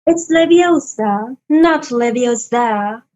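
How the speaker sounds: slow, precise British schoolgirl correcting; Hermione impression. Hermione correcting Ron's spell, slow and prim. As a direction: correct him like Hermione, slowly. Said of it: "do you know Hermione from Harry Potter?".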